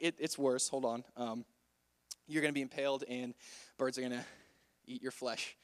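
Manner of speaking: low, soft, halting delivery; awkward. Retold or imitated. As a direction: reluctantly mumble the bad news with long hesitations. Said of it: "how awkward it was".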